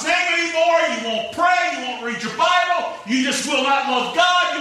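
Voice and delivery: high, full-force preacher's dare; shouted challenge. Retold or imitated. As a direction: holler the offer out to a roomful of kids. Acts out his challenge to children.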